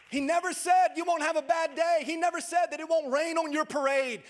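loud, high, driving preacher's voice; preaching at full force. Acted. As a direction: rouse the congregation with rising fervor.

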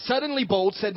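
ordinary talk, nothing marked

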